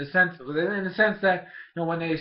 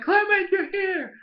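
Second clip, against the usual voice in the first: much higher, loud, happy cry of relief; excited Ash; 4 dB louder than usual. Retold or imitated. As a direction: greet a friend who just saved the day. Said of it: "all excited".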